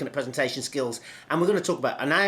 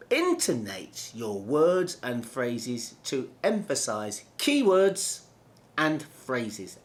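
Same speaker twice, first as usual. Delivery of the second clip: slow, leaning on keywords and phrases; slow with emphasis; slower than usual. Shown as a demonstration. Coach demonstrating vocal variation, slow and stressed. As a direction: stress each key word in waves. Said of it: "I'm still speaking slowly, but I'm now putting an emphasis".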